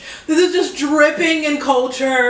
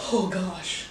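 quietly nervous, voice dropping low; nervous and soft; 7 semitones lower, 11 dB quieter than usual. Real, not acted. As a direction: murmur it under your breath, jittery. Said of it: "I'm nervous".

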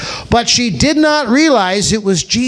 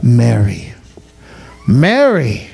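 tender call; 8 semitones lower than usual. Acted. Jesus calls Mary by name.

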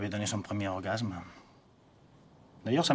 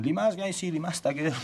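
higher-pitched mock bawling at a dog; imitated dog-owner yelling; 8 semitones higher, 3 dB louder than usual. Imitating a friend calling his dog, mock yell. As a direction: yell it as an exasperated owner's impression. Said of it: "il passait son temps à gueuler après sa chienne".

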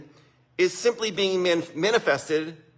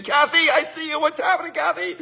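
higher-pitched friendly call-out; excited greeting; 8 semitones higher than usual. Real, not acted. Host spots another live viewer mid-story, excited greeting. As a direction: greet them with a delighted lift.

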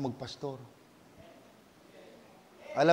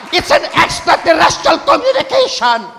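building preacher's fervor, louder; preaching; 9 semitones higher, 14 dB louder than usual. Acted. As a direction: preach it with rising conviction. Preaching at full force.

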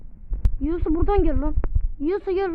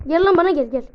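loud challenge; 2 semitones higher, 9 dB louder than usual. Real, not acted. Calling enemy to fight.

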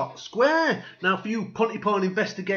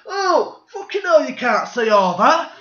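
louder and higher, aghast; outraged member of the public; 6 semitones higher, 6 dB louder than usual. Acted. Public shocked by the placards, loud outraged cry.

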